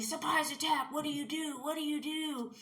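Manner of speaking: high-pitched mock ambush chant; teasing guy friends. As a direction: pester like friends springing a surprise attack. Friends jumping her, acted out.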